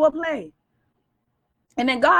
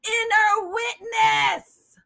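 slow drawn-out answer; 6 semitones higher, much slower than usual. Shown as a demonstration. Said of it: "us by the what?".

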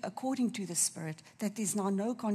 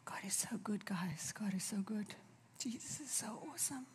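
very soft, near-whispered praise; overcome with emotion; 10 dB quieter than usual. Real, not acted. Preacher pauses while telling of her sister's loss, overcome and hushed. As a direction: struggle to compose yourself, barely voiced. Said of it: "just a moment".

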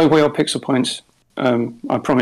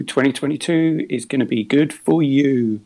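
ordinary talk, nothing marked

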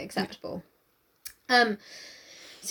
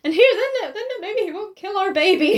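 high, insistent, raised voice; mock pleading; 8 semitones higher, 4 dB louder than usual. Wife reasoning about saving baby, high mock pleading. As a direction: plead it up high with comic urgency. Voicing the wife's plea to Darcy.

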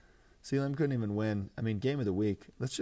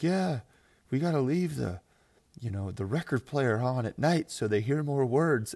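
lightly voiced politician, louder and higher; mock Sleepy Joe; 5 semitones higher, 6 dB louder than usual. Candidate answering on education, mock earnest and loud. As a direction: loudly imitate a rambling debate answer.

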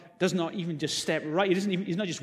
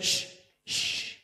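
hushing; 4 dB quieter than usual. Retold or imitated. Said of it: "the crowd told him".

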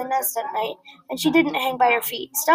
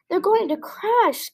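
slow, higher, alarmed; gasped in alarm; 8 semitones higher, slower than usual. A narrator's reading. Stella Luna watching the birds fall, alarmed gasp. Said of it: "gasped Stella Luna".

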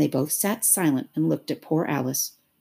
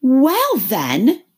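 high, loud, emphatic; loud creature retort; scornful Gryphon; 10 semitones higher, 9 dB louder than usual. A narrator's reading. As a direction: press the point loudly. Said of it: "the Gryphon went on".

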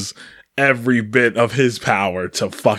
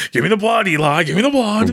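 higher mock Daniel pleading; quoting Daniel's shout; 9 semitones higher, faster than usual. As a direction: imitate the film line, pleading. Quoting Daniel's line from film.

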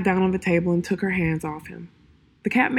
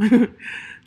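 light laugh; 4 semitones higher, 4 dB louder than usual. Real, not acted. Reader laughs after the story.

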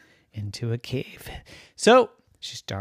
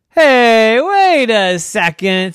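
high, loud sudden stop; dawning realization; 9 semitones higher, 10 dB louder, slower than usual. Acted. Trisha realizing her sister fooled her, sudden loud stop. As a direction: catch on all at once.

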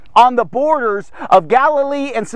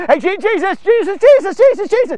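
high, frantic overlapping-style calls; mock crowd screaming; 9 semitones higher, 3 dB louder than usual. Ten lepers all calling Jesus at once, mock screaming. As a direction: rattle the name off high like a clamoring crowd. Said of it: "everyone's screaming at the same time".

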